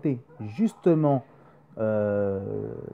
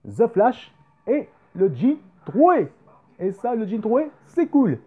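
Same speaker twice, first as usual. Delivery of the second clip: excited show-off; 8 semitones higher, 3 dB louder than usual. Real, not acted. Showing t-shirt excitedly.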